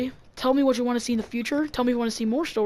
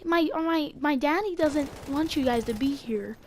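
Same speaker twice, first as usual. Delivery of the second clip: put-on higher, small child voice; little kid; 4 semitones higher, 4 dB quieter than usual. Little kid warning off visitors, higher child voice. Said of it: "So this little kid's like".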